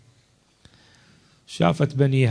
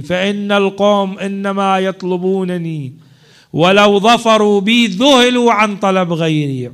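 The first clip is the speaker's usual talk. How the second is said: higher and louder, preached with full force; rising proclamation; 5 semitones higher, 6 dB louder than usual. Acted. The Imam urges his companions to leave, louder proclamation. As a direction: repeat the Imam's plea, now swelling and louder. Imam's words repeated, building.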